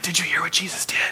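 hushed, whispered buzz of gossip; excited crowd chatter. Acted. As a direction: whisper it like news spreading through a crowd. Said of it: "hear the buzz and the chatter".

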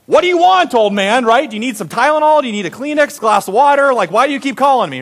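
high, loud exasperated outburst; hammed-up exasperation at being pestered; frustrated. [name] to the old guy next door, frustrated. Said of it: "getting pretty frustrated".

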